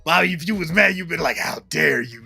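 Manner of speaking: high, loud teasing imitation of a friend; hammed-up mock indignation; clowning. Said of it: "B started clowning".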